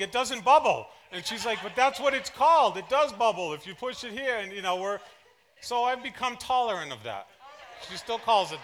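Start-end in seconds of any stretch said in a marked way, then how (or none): 0.0-0.9 mock yell, pitched higher and louder; retold yelling. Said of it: "I'm yelling". 1.6-4.4 insistent wife. Retold or imitated. Wife insisting in bubbler argument.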